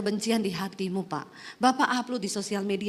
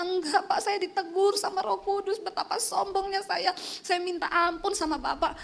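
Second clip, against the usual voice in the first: tearful apology; 7 semitones higher than usual. Retold or imitated. Tearful apology retold on the phone.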